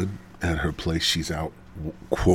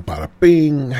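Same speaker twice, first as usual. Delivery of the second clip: higher, louder, punchy bada bing; 8 semitones higher, 9 dB louder than usual. Real, not acted. Capping the night with a loud bada bing. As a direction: pop it like a punchline.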